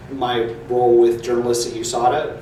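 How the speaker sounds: usual speaking voice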